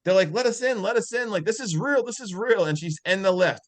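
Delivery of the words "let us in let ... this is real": high-pitched mock pleading; acted urgent pleading. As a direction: imitate kids begging to be let in. Acts out kids locked out.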